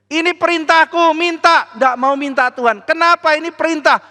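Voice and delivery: high, loud back-and-forth; God and the king bickering over the sign; heated argument. Acted. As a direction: quarrel insistently. God and Ahas arguing.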